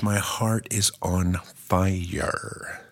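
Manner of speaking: slow whispered confession; breathy whisper. Acted. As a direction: whisper it slowly, like a secret. Jesus declaring love, whispered.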